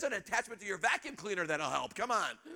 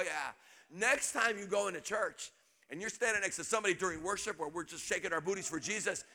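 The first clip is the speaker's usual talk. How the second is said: ordinary talk, nothing marked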